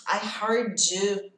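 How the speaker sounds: "I heard you" with the d and y joined into a j; "heard you" run together, slowly; linked sounds. Shown as a demonstration. Teacher shows the linking in the song lyric. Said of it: "Que ele junta o D com o Y".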